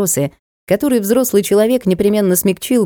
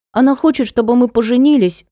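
put-on high-pitched child confiding; little boy; 6 semitones higher than usual. A narrator's reading. Small boy explains about his friend. (высоким детским голоском)

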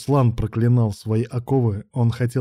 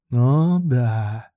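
drawn-out, gloating drawl; predatory drawl. A narrator's reading. Man relishing his power over his partner, predatory drawl. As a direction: stretch it out with a predatory smirk. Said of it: "протянул с хищной усмешкой Илья".

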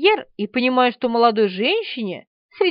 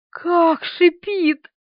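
slow, higher horrified question; aghast, voiceless; 5 semitones higher, much slower than usual. A narrator's reading. Woman asks how the roach hisses, aghast. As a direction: stretch it out in frozen disbelief. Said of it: "беззвучно переспросила Полина".

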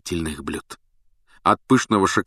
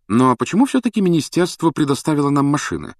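higher-pitched pompous question; officious, pitched-up querying; self-important; 7 semitones higher, 4 dB louder than usual. A narrator's reading. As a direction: insist on an answer with dignity. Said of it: "важно повторил Перси".

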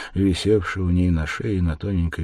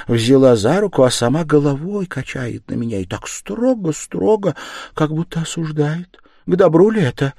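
put-on raised, worried older woman's voice; fretful mother, higher, anxious; uneasy mother; 8 semitones higher, 6 dB louder than usual. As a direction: tell the dream as a bad omen. Mother retelling ominous dream.

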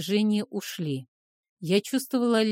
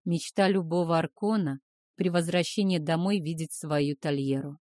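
ordinary delivery

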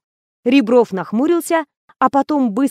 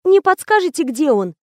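high, meek question; lightly flustered, polite plea; babbling timidly; 6 semitones higher than usual. A narrator's reading. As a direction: ask timidly in a raised voice. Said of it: "залепетала актриса".

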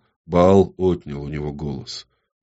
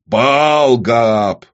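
louder, higher commanding bark; 5 semitones higher, 6 dB louder than usual. A narrator's reading. Firstborn demon cutting the woman off, barking. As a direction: bark the title as an order. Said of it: "рявкнул первородный".

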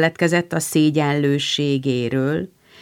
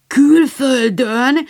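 high, slow astonished cry; Joe, amazed he lived abroad, cries out; surprised exclamation; 7 semitones higher, 4 dB louder, much slower than usual. Acted. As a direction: exclaim it in wide-eyed surprise. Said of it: "kiáltott fel Joe".

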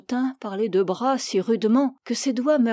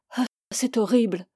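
ordinary delivery, nothing marked

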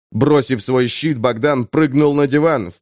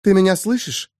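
lightly higher woman's voice in head; Eva's voice; 6 semitones higher than usual. A narrator's reading. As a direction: call him from inside his head. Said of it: "голос Евы".

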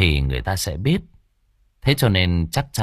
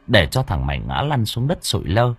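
ordinary delivery

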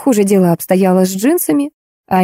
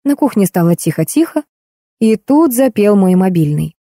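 plain reading voice

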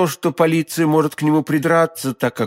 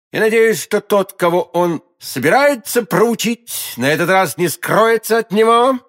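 raised, louder challenge; exclaiming; 7 semitones higher, 4 dB louder than usual. A narrator's reading. D'Artagnan confronting the stranger on the doorstep, exclaiming. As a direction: throw down a defiant challenge in a higher voice. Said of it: "воскликнул он".

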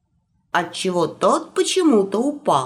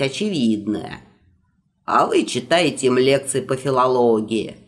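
put-on old magister voice; 7 semitones lower than usual. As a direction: lecture like a fussy old wizard. Magisters argue in low voices.

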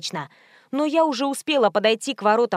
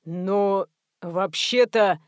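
very slow, stretched stalling; hesitant manager; 2 semitones lower, much slower than usual. A narrator's reading. Manager stalling the insistent caller, hesitant. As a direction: drag the words out as you hedge.

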